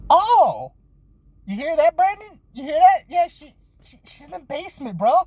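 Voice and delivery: high, gleeful can-you-believe-it; excited disbelief. Retold or imitated. Officer gleefully telling partner Brandon the news, very high.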